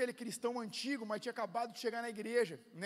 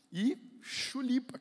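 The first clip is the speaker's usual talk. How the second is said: "chulipa" drawn way out; stretched-out word; 3 semitones higher, 5 dB louder, much slower than usual. Retold or imitated. Pastor retelling his plan for Tokyo, drawn-out "chulipa". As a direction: stretch "chulipa" slowly for effect.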